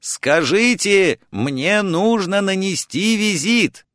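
high, insistent phone shout. A narrator's reading. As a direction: call out the message down the receiver. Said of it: "Я закричал в трубку".

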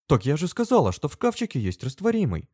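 high-pitched, hurt protest; offended. A narrator's reading. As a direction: protest as if insulted. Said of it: "обиделась она".

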